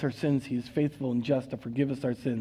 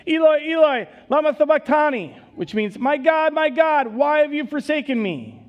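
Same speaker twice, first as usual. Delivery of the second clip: anguished high loud cry; loud anguished outcry, much higher; 12 semitones higher, 7 dB louder than usual. A narrator's reading. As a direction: cry out to God in agony. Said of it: "Jesus cried out in a loud voice".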